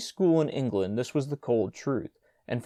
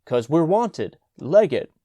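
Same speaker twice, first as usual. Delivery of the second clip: loud hurried urging; urgent call; 4 semitones higher, 8 dB louder, slower than usual. A narrator's reading. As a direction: hurry him on loudly. Major urging brother to run.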